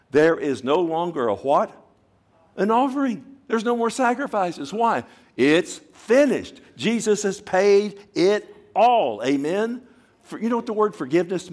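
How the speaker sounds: high, loud call to the crowd; preaching climax. Real, not acted. Preacher to congregation, high ringing proclamation. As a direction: drive it home like a preacher.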